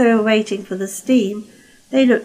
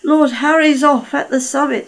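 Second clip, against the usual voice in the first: higher, louder alarm; urgent manager; 6 semitones higher, 4 dB louder than usual. Acted. The manager alerts Caldy's crew, urgent and higher. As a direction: report the trouble briskly.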